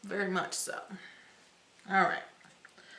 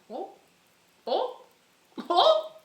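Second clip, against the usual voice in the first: rising squeal; 11 semitones higher, 5 dB louder than usual. Real, not acted. Rising delight at glow.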